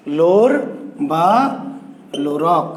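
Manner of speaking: লোর, বা and লোরক each set apart by long pauses; slow spaced-out naming. Shown as a demonstration. Teacher spelling the hero's name to students, slow and spaced. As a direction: spell out লোর ... বা ... লোরক slowly, one word at a time.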